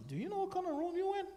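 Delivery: impressed nurse asking with wide-eyed excitement; amazed nurse. Retold or imitated. Nurse quoted, amazed about the room.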